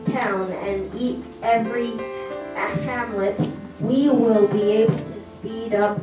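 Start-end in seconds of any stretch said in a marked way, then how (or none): none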